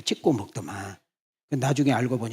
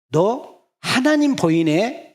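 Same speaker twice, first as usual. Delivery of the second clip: slow, high and loud exclamation of wonder; drawn-out amazed cry; exclaiming; 9 semitones higher, 5 dB louder, much slower than usual. Acted. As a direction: marvel out loud at the discovery. Preacher voicing a believer's recognition.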